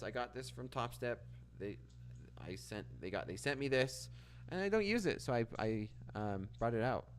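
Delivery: ordinary talk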